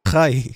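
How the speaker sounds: "Hi" loud, with a scraped throaty h; accented hi. Retold or imitated. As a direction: call out "Hi" with a Spanish j for the h. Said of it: "not hi, but hi".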